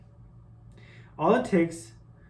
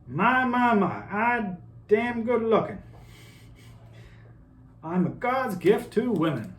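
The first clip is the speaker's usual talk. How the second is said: put-on higher, preening southern accent; 8 semitones higher than usual. Vain person admiring themselves in the mirror, southern accent. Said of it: "I did a southern accent".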